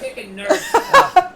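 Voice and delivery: high, slow laughing squeal. Real, not acted. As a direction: laugh hard through it. Laughing punchline.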